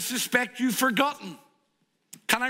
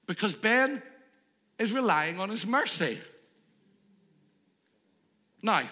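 usual speaking voice

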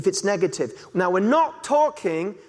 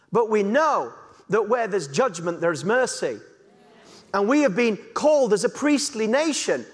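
normal talking voice

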